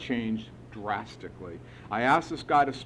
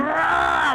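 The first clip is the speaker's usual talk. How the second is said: loud, high-pitched yell of frustration; acted-out exasperated shriek; frustrated scream; 7 dB louder than usual. Sponsee at the end of his rope with sponsor, frustrated scream. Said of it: "I was so frustrated".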